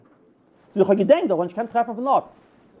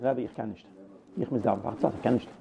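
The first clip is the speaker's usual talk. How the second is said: low, hushed voice of someone begging off; retold apologetic refusal; 8 semitones lower, 9 dB quieter than usual. Attendant declining the Rebbe's request, quietly apologetic. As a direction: turn the request down softly and low.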